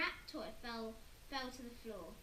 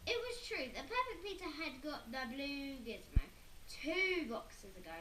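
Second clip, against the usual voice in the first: ordinary delivery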